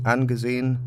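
ordinary talk, nothing marked

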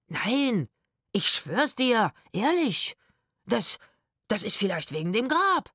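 plead, tripping over your words; stammering. A narrator's reading. Man swearing innocence to his partner, stammering. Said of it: "stotterte der Mann".

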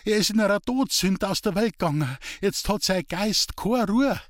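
high-pitched, trembling lament; tearful fright. A narrator's reading. Women hearing the dead Steffel's voice, tearful fright. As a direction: wail it in terror of a ghost. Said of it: "heftig zu zittern und zu weinen".

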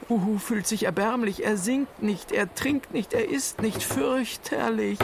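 very high, anxious fretting; worried high voice. Acted. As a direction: fret in a squeaky worried voice. Worried friend calls for doctor.

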